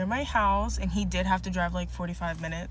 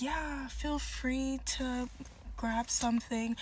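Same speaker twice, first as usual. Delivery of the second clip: imitating him, slow, soft and higher; lordly nonchalance; 4 semitones higher, 7 dB quieter, slower than usual. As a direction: drag it out softly, as if owning the place. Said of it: "as if he owned the whole damn store".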